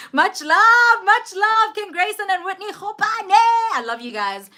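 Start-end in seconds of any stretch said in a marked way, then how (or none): 0.1-3.4 high, bright shout-out; excited thanks. Real, not acted. Thanking viewers by name, pitched high and excited.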